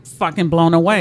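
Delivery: normal talking voice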